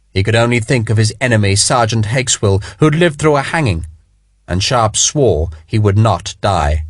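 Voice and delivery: usual speaking voice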